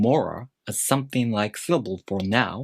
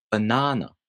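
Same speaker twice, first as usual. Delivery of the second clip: second syllable of "banana" louder and longer; English stress. Shown as a demonstration. Teacher showing learners English stress, "banana" stressed on the second syllable. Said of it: "second syllable is pronounced louder".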